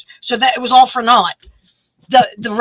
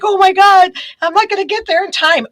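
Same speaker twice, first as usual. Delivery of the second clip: higher, fretful retold worry; upset; 9 semitones higher than usual. Late for the airport while driving, upset and high. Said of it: "I'm really upset 'cause I'm like".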